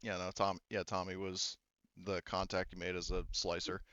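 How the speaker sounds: ordinary delivery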